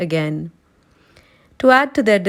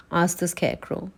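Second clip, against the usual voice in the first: ordinary delivery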